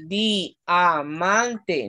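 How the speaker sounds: "diamante" stretched very slowly, syllable by syllable; syllabified slowly. Shown as a demonstration. Teacher showing the class where diamante splits, very slowly. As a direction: drag out "diamante" so each syllable is heard. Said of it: "Porque la I y la A forman un diptongo".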